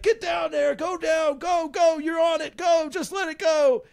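high, urgent imitated sergeant yell; drill sergeant yell. Sergeant urging a frightened student down the wall, imitated high yelling. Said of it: "his drill sergeant mode".

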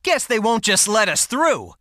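ordinary talk, nothing marked

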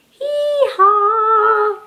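high sing-song hee-haw; donkey bray. Shown as a demonstration. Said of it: "Can you bray like a donkey".

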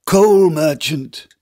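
high, slow plea called out; a freezing beggar calling up the stairs; hollow cold voice. Acted. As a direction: call up to him, frozen and pleading. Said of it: "in a voice burned hollow by the cold".

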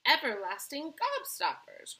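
high, loud, drawn-out announcement; proud cry. A narrator's reading. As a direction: proudly unveil a marvel, voice lifted. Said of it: "cried Mister Wonka proudly".

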